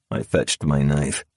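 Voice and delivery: usual speaking voice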